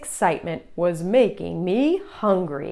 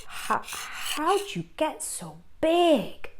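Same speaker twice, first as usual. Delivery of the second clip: gasped question; 8 semitones higher, 3 dB quieter than usual. Acted. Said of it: "I gasped".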